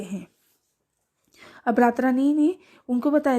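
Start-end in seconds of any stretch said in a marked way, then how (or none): none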